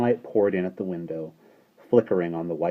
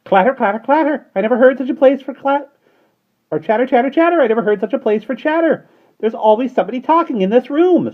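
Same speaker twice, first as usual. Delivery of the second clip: much higher, loud scolding bird; shrill starling voice; 11 dB louder than usual. A narrator's reading. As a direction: squawk it like a cheeky starling. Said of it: "said a shrill voice".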